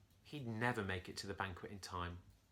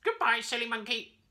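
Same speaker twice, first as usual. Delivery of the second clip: high, loud squawky cackle; cackling parrot; 12 semitones higher, 9 dB louder than usual. A narrator's reading. Parrot mocks the monkey while flying away, high and loud. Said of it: "the parrot cackled".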